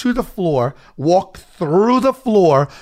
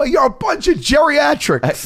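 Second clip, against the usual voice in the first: high, slow hurled insult; retold heated insult; 10 semitones higher, slower than usual. Dancer to old concertgoers, retold heated insult. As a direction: hurl it back at the hecklers.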